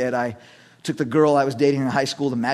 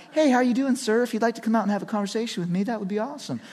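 higher, soft, casual mock-friendly invitation; polite suggestion; 6 semitones higher, 4 dB quieter, faster than usual. Imagined nice cop inviting a driver to chat, mock polite. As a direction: offer it lightly, as if entirely optional. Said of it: "He wasn't like".

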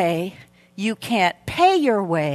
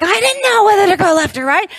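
flustered panic; 9 semitones higher, 4 dB louder, faster than usual. Retold or imitated. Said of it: "And I'm like".

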